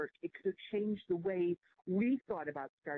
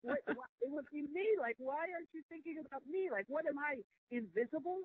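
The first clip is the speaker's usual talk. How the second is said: indignant inner voice; 8 semitones higher than usual. Retold or imitated. She silently protests the board's search, pitched high and indignant. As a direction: replay the outraged thought pitched up. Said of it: "And I was thinking".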